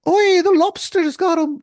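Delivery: high, loud mock Irish mother's cry; a quick, hammed-up Irish mum voice; attempted Irish accent. Mother finding her son, in a mock Irish accent. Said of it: "I can't do the accent really".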